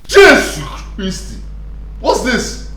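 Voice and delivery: high, loud disgusted shrieks; horrified scream. Acted. Son screams in horror at the food he was served. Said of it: "Why are you screaming like this?".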